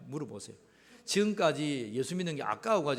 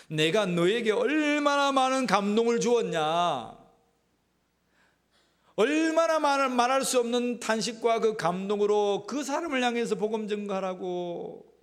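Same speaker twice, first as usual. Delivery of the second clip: loud, higher, drawn-out cry; impassioned divine reproach; 5 semitones higher, 8 dB louder than usual. Acted. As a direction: plead as God, crying out at full voice. 하나님의 호소를 대신 외침. (하나님의 애타는 호소처럼 크게 외치는 말투)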